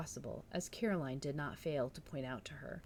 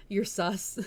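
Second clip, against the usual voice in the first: ordinary delivery, nothing marked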